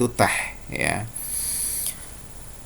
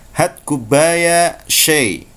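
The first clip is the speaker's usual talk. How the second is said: very slow, drawn-out Saudi Arabic request; Saudi Arabic accent; 4 semitones higher, much slower than usual. Shown as a demonstration. Teacher demonstrating how Saudis ask for tea. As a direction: order tea like a Saudi local, slowly. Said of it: "penduduk Saudi akan mengatakan".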